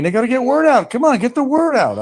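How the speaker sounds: urging. Real, not acted. Urging viewers to spread word.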